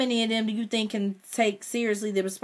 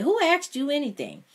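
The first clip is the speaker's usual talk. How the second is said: sharp, much higher challenge; indignant retort; 10 semitones higher than usual. A narrator's reading. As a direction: cut him down, offended. Narrator snaps at mother's guest.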